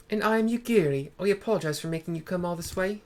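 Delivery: put-on a half-found accent for Yugiri; mis-hit accent for Yugiri; failed Yugiri accent. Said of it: "No, that's not her accent".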